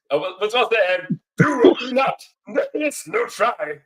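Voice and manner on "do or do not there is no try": high, slow, hammed-up little sage; Yoda impression. Guest proudly trying Yoda for podcast friends, slow high hammed voice. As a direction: stretch the words like a tiny wise master. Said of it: "I'll do a Yoda impression".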